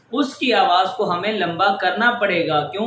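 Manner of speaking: ordinary delivery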